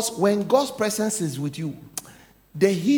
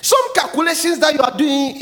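ordinary talk, nothing marked

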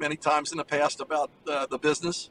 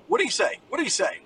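peppering questions; 7 semitones higher, faster than usual. Retold or imitated. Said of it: "he's just peppering me".

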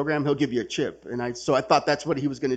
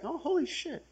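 stunned realization; 13 semitones higher, 8 dB quieter than usual. Retold or imitated. Realizes judge will out him.

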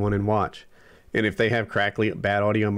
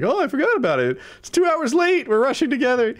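mock flustered; 3 dB louder than usual. Mocking their own late starts.

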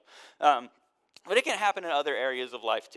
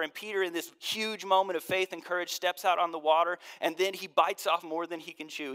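ordinary talk

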